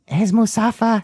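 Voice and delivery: high, odd little alien voice; Musafa voice. Acted. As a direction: slip into the squeaky Jawa character. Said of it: "talk in his voice".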